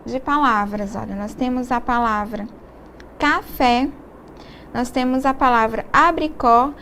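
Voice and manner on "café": usual speaking voice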